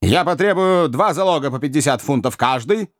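pompous magistrate pronouncing; loud and dignified. A narrator's reading. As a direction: declaim it with great dignity. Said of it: "громко и с большим достоинством".

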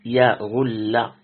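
يغل said slowly as "yaghull", opening on "ya"; fatha on the ya. Shown as a demonstration. Teacher shows a variant Quran reading, يغل as a slow "yaghull". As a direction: draw out يغل with an a after the ya, u after the ghayn. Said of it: "وقرئ بفتح الياء".

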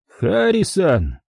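high, drawn-out taunting voice; sinister high voice on the phone; menacing caller. A narrator's reading. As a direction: call the name out high and slow.

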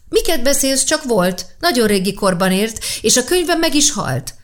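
put-on a child correcting a grown-up, bright and sure; little girl voice. Sasha correcting old Karl about the book, lightly voiced little girl.

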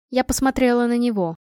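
ordinary delivery

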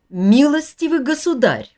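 sharp indignant rebuke. A narrator's reading. Widow rebukes an unwanted visitor, sharply and loudly. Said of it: "зазвучал громче и стал резким, словно удары хлыста".

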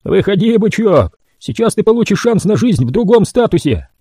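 high, jeering call; gloating mutant. A narrator's reading. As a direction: taunt the prisoner out of the cage. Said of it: "захохотал".

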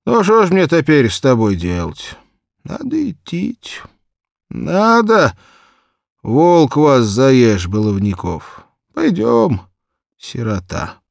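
high, put-on old shoemaker voice; grumbling old man. Drunk shoemaker grumbles at the girl, put-on old man voice. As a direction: sigh over the trouble, head shaking. Terentiy decides to go.

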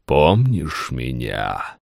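low, slow, otherworldly voice; echoing ethereal energy being. Acted. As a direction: speak like something not of this world. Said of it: "заговорил снова чужим, неземным голосом".

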